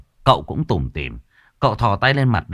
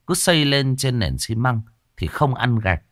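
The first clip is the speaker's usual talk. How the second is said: usual speaking voice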